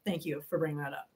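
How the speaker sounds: low, soft repeated thanks; muted aside. Real, not acted. Streamer thanking Wanda for the reminder, muted aside. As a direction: drop right down, almost to yourself.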